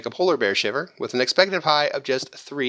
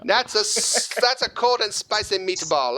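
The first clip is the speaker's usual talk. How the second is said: put-on higher-pitched comic Italian accent; 6 semitones higher, slower than usual. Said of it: "That was my Italian accent".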